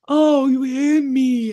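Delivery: loud, high, very slow wail; wounded alien. Retold or imitated. Punched alien complaining to the elf, drawn-out loud wail. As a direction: moan it in hammed-up hurt. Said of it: "talked to the ten-foot alien".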